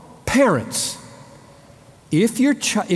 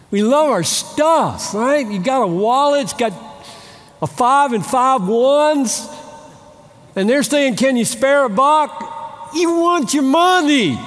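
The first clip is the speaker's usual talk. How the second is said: building emphasis; 8 semitones higher than usual. Acted. Preacher building to a peak.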